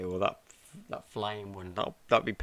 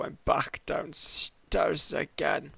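ordinary delivery

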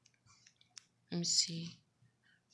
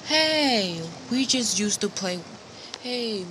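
high, loud burst of excitement; excited discovery; 5 semitones higher, 7 dB louder than usual. Pretend. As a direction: exclaim brightly at a happy find. Excited discovery of old sword.

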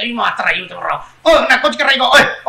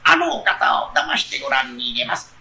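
sly fox; 9 semitones lower, 4 dB quieter than usual. Acted. Fox points out the woman.

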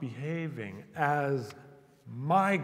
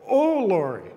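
put-on old-fashioned call; 5 semitones higher, 8 dB louder than usual. Shown as a demonstration. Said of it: "if I were living a hundred years ago".

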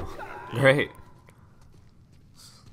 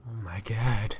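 shocked whisper; slower than usual. Real, not acted. Reacting to guard beating prisoner.

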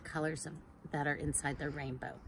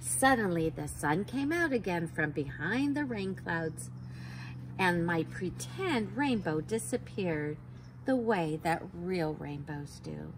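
bright, higher storybook reading; reading to children; 5 semitones higher than usual. A narrator's reading. Teacher reads a rainbow book to kids, lively. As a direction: read it like a picture book to little ones.

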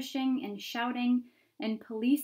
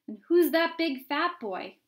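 crowd onlooker; 6 semitones higher, 7 dB louder than usual. Acted.

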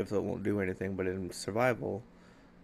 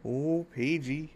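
slow, wistful and a touch higher; emotional sigh; 5 semitones higher, slower than usual. Real, not acted. Missing his niece Paige, wistful and slow. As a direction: let the name melt with longing. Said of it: "getting all emotional".

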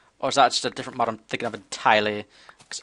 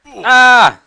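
startled cry; 10 semitones higher, 15 dB louder than usual. Real, not acted. Attacked in game.

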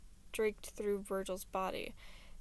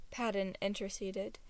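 plain reading voice

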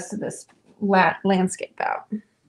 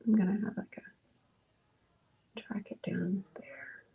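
whispering; 12 dB quieter than usual. Real, not acted. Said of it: "whispering while painting".

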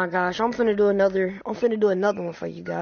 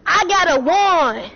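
mock threat; 9 semitones higher, 5 dB louder than usual. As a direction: drag it out like a menacing wizard. Boasting slowly in character.

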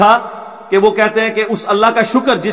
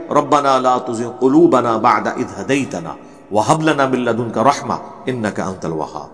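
slow, low melodic chanting of the verse; slow and low Quranic chant; Quran recitation; 6 semitones lower, 3 dB quieter, slower than usual. Acted. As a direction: recite it like tilawat, drawn out.

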